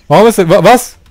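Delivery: ordinary talk, nothing marked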